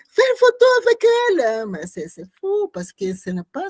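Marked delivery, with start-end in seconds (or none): 0.1-1.4 fast, high and loud; a sudden high urgent snap; urgent retort. Retold or imitated. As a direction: fire it off quickly and sharply. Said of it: "et j'ai dit".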